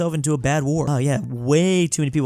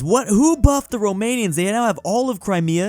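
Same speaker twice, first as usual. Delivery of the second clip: surprised; 7 semitones higher than usual. Real, not acted. Romania suddenly huge.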